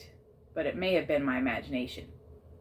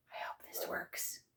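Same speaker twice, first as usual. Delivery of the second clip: hushed, slow whisper; 14 dB quieter, slower than usual. A narrator's reading. Narrator to himself, whispered hope. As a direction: breathe a hopeful wish.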